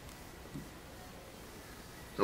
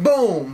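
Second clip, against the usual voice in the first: higher-pitched vocal blast; imitated boom; 5 semitones higher, 4 dB louder than usual. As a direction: burst out an explosion noise. Imitated explosion.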